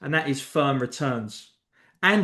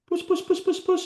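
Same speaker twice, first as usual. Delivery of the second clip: much higher, sing-song cat call. Shown as a demonstration. Calling a cat over with a high coaxing call. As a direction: call the cat like you mean it. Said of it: "you put your hand and you go".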